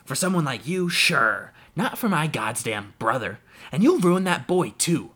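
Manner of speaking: put-on a drunk man shouting his scorn; angry shout. A narrator's reading. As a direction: hurl it at her in contempt. Jem shouts at his brother's wife.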